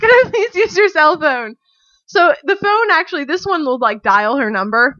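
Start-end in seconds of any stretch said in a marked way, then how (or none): 0.0-1.5 higher, louder, laughing relief; relieved gush. Retold or imitated. Stranded traveller thanking the German tourist, gushing relief. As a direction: gush at your rescuer. Rescued by English speaker.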